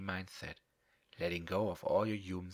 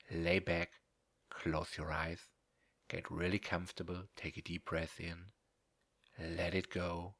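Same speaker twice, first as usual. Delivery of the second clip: normal talking voice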